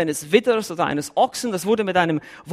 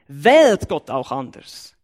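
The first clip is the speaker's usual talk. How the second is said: ordinary talk, nothing marked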